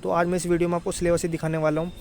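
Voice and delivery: ordinary delivery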